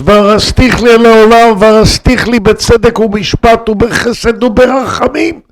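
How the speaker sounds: high, rising recitation of a verse; impassioned proclamation. Retold or imitated. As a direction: proclaim the holy words high and unbroken. Verse repeated as proclaimed from gallows.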